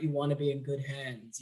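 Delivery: calm the listener like a trusted insurance ad; Allstate man. Retold or imitated. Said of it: "the Allstate man".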